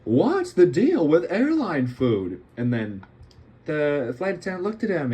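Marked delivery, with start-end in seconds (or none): 0.0-2.4 loud, high-pitched comic impression with stretched words; Jerry Seinfeld. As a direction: ham up the stand-up catchphrase, swooping high. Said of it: "And he said".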